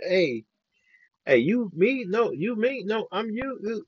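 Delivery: high-pitched back-and-forth pointing routine; excited mock bickering. Retelling the Spider-Man meme to viewers, high mock bickering. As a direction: act out a comic mix-up between lookalikes.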